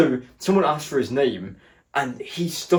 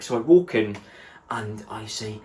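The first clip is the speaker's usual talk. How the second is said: usual speaking voice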